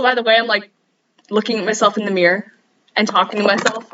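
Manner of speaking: usual speaking voice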